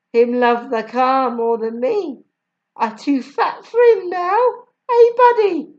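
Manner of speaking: teasing about being too fat; Jamaican accent. A narrator's reading. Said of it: "I will try my best".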